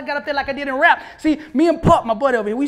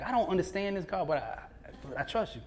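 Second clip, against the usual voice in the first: hushed prayer; 3 semitones lower, 11 dB quieter than usual. Retold or imitated. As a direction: murmur it like a puzzled man trusting God. Company head praying, unsure.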